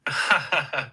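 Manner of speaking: high-pitched burst of laughter; high, repeated ha-ha laugh. Real, not acted. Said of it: "Hey, dude, don't, um, laugh".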